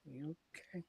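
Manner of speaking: softly asking; a hushed careful you okay; tentative murmur; quiet check-in. Retold or imitated. Checking on silent mom.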